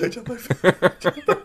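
slow laughing repeat. Real, not acted.

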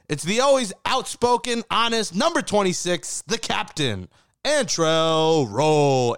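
announcer build-up, high at the start, the name drawn out very slowly; big show intro that stretches out the guest's name; hype guest intro. Acted. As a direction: introduce the star like a ring announcer. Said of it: "I need to make sure I record that, that intro".

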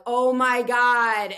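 slow, drawn-out oh my God; panicked realization. Retold or imitated. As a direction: let the dread dawn slowly.

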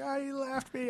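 put-on high, soft little-kid voice; imitating a small child. Retelling the kid's answer, high little-kid voice. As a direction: mimic a small abandoned kid. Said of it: "He's like".

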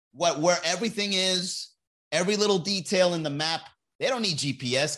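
ordinary delivery

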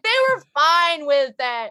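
high, drawn-out clueless American voice; idiot American. Acted. Mocking own excuse to friends, idiot American voice. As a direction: parody a self-excusing American, slowly. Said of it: "sounds like the idiot American".

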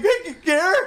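high mock call of the name. Podcaster mimicking the bystander calling Gary. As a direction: call out to him, hammed up. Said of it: "Like, just like".

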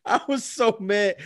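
slow, high, through real laughter; laughing. Real, not acted. Man admitting his anger to his co-host, laughing. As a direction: wheeze it out while still amused.

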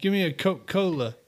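Coca-Cola said as Coke-Cola, no middle "ka"; Sam Pete way. Retold or imitated. Trying out a friend's local way, Coca-Cola as Coke-Cola. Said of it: "Sounds like a Sam Pete way to say it".

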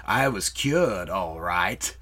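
low, slow, drawn-out sarcasm; sarcastic Alex. Acted. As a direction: drop low and savour each word with irony. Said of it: "just sarcastically being like".

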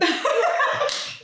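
real laugh shooting way up; a high delighted laugh. Real, not acted. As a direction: crack up at your friend's joke. Laughs at cohost's self-cussing.

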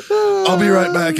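imitated high, loud, slow embarrassment; flustered. Embarrassed young corpsman excusing herself from patient, flustered. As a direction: pitch it up and stretch it out, mortified. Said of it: "she just fucking turns bright red".

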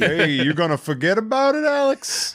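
hammed-up, high-pitched New York wiseguy; mock New York accent. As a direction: tease him in a cartoon New York accent. Mock New Yorker teasing Alex.

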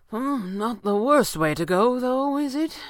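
high, drawn-out musing; musing aloud. A narrator's reading. Stranger musing to no one as water rises. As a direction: muse to yourself, rising at the end. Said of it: "he said to no one in particular".

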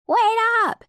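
high loud yell after him. Acted. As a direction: shout to stop him running. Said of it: "Slick and Slicker yelled out".

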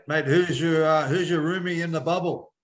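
ordinary talk

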